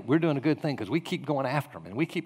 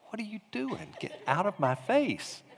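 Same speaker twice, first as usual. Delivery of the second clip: hushed, higher-pitched inner fuming; mad, held in; 7 semitones higher, 5 dB quieter than usual. Retold or imitated. Pastor's private thought at a crowding congregant, contained anger. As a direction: seethe silently, keeping the volume down. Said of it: "Now I'm mad. Not angry outwardly".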